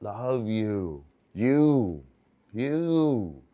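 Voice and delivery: mock-tender coo; talking to parents. Shown as a demonstration. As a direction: sweet-talk a parent who's on the moon. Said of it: "talk like this".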